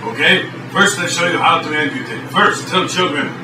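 broken-English Russian host, deadpan; mock Eastern European TV host; fake Russian accent. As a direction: play a stern Russian cook in broken English. Boris sketch continues.

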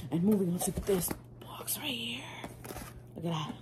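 whispered segue to the next box. Real, not acted. Vlogger whispering to viewers as she moves on. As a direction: hush it like a secret.

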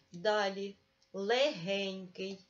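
легенький drawn out slowly, г clearly voiced; slow, deliberate легенький for the pupil; slow emphasis. Shown as a demonstration. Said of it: "змінюємо наступне слово".

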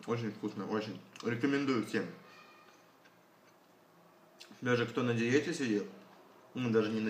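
talking with mouth partly full; eating salad while speaking; chewing. Real, not acted. Seen 0: fork of salad in hand, cheeks bulge as chewing between words.

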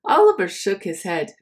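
usual speaking voice

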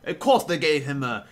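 badly faked Australian accent, hammed up. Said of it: "I can't do an Australian accent".

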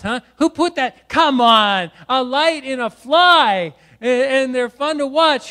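fired-up, exclaiming preacher; full-force preaching. Real, not acted. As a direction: preach it out to the whole room, punching key words.

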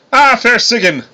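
high-pitched, courtly god voice; grand greeting. A narrator's reading. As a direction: hail a lady with ceremony. Thor grandly greets Sigyn.

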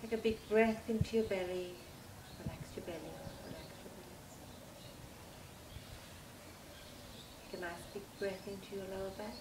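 soft, hushed meditation voice with long pauses; guided meditation. Acted. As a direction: lull them gently through a body relaxation.

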